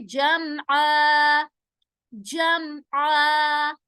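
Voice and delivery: जमआ with the voice held on म, no bounce; जमआ very slow, no qalqala on मीम; stopped voice. Shown as a demonstration. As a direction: draw out जमआ slowly, stop the sound cleanly. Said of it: "आवाज को रोकेंगे".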